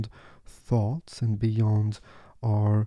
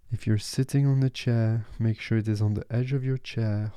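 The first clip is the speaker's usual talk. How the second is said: ordinary talk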